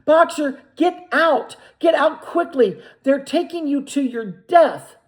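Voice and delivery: high, loud anguished cry; terrible cry. A narrator's reading. Clover crying to Boxer at the van window, terrible voice. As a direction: scream his name in horror. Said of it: "cried Clover in a terrible voice".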